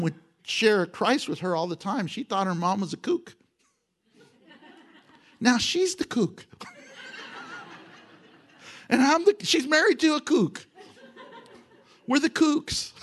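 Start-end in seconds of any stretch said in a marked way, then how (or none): 5.4-12.9 laughing through the words at himself. Real, not acted. Pastor joking about his family, laughing. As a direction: chuckle it out, amused.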